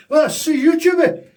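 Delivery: very high, loud Scottish caricature; Scottish accent. Pretend. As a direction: shout it like a cartoon Scot. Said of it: "We try Scottish accent then".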